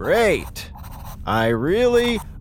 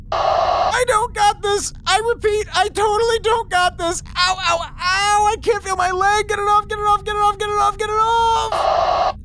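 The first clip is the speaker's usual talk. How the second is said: high, frantic yelling in panic; phobic panic. Acted. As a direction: scream it out, pitch way up. Suit attacks him, yelling in pain.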